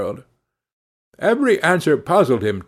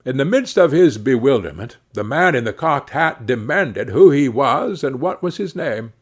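usual speaking voice

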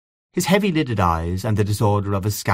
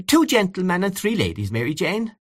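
crying out; 10 semitones higher than usual. A narrator's reading. Aunt Kate hurrying in to organise dancers, calling out. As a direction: call out the order in a raised pitch. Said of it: "came Aunt Kate crying".

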